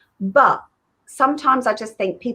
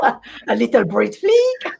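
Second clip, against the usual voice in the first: slow, drawn-out mock-French accent; 2 semitones higher, much slower than usual. Shown as a demonstration. Guest teases co-host's phrase with a French accent. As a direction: draw out FLICK as a French-sounding "fleek". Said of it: "it's very French".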